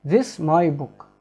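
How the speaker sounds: "This my book" slow, with no "is"; slow reduced sentence. Shown as a demonstration. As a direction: drop the is and read it slowly. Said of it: "We can translate it, it's like".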